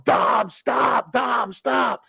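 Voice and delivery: high, urgent yells to stop; pleading father's shout. Retold or imitated. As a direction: imitate a frantic dad calling out.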